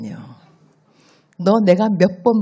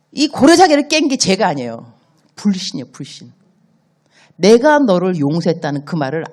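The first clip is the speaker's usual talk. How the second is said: ordinary delivery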